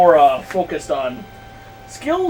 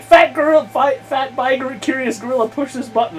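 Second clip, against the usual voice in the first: lightly voiced teasing child, higher pitch; mocking bratty kid; 7 semitones higher than usual. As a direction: needle the big guy like a cheeky brat. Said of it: "he'll be like".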